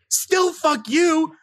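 high, slow mock yell of defiance; retold yell. Comic to hosts, retold yell at bead guy, high and slow. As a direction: drag it out, indignant and high. Retold street spat escalating.

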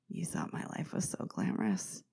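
breathy low whisper, wry and confiding; whispered aside. Acted. Podcaster confiding to listeners in a wry whisper. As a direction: lean in and whisper the joke.

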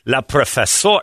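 usual speaking voice

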